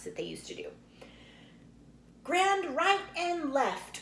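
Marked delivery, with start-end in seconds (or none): 2.2-4.0 high, slow dance caller's call. A narrator's reading. Pa calling the square dance, called out high. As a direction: sing it out across the room. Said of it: "Pa called out".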